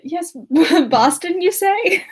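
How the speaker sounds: hammed-up fake-casual suitor, laughing; mock eager Archer. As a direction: play up his sudden keen interest with a giggle. Mocking Archer's eager reaction.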